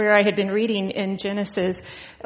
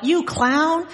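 mild mock yell, pitched up; retold yell; 8 semitones higher than usual. Retelling an angry dad cut off in traffic, mock yell. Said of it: "he yelled out".

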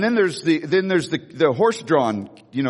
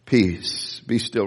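calm command; 6 semitones lower, much slower than usual. Retold or imitated. Jesus calming the winds and waves, low and calm. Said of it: "Jesus just stands up".

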